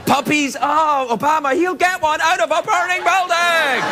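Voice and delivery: high-pitched, excitable rush of catchphrases; frantic monologue recap. Acted. Host recapping the monologue against a thirty-second clock, frantic and high. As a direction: race the clock, voice pushed high.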